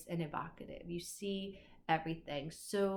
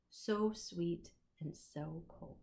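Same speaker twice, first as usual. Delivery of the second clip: savoring slowly; 5 dB quieter, slower than usual. Shown as a demonstration. Last line of poem read.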